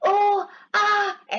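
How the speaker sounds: slow, high mock groaning; hammed-up groan. Tom faking pain to fool his aunt, slow mock groans. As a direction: drag out a fake groan.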